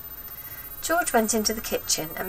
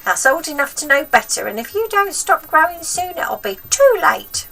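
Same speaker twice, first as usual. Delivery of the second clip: put-on high, loud cranky old woman; bossy wicked granny, pitched up and loud; grandma voice; 9 semitones higher, 6 dB louder than usual. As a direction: nag the boy like a spiteful old grandma.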